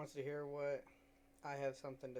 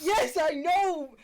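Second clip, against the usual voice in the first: excited shout; 13 dB louder, much slower than usual. Real, not acted. Loud excited admission.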